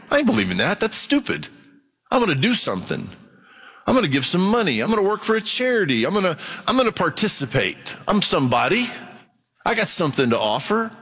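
a hammed-up boastful unbeliever, fast and high; proud objector. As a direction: play the smug doubter who thinks he's somebody. Preacher voices proud objector.

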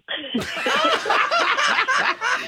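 real high cackle of delight; high burst of laughter. Real, not acted. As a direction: break into a squealing laugh. Caller cracks up at own story.

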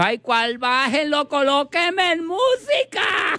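very high, camp shouted demand. Acted. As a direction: shout happily for music.

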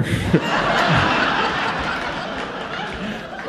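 high, airless cackle; high wheezing laugh. Real, not acted. Comic cracks up at the marker smell, wheezing laugh. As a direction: laugh until no sound comes out.

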